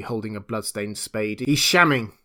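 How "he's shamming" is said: louder, mildly indignant call; accusing cry. A narrator's reading. Big navvy accusing the captive, loud cry. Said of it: "cried the big navvy".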